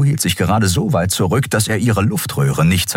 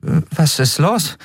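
higher-pitched puzzled question; confused; 10 semitones higher than usual. A narrator's reading. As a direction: ask it bewildered, voice lifted. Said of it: "fragte Endriel verwirrt".

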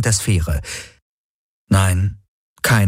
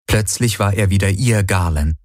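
usual speaking voice

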